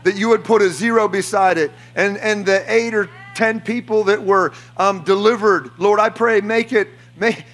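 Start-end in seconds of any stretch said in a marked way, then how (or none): none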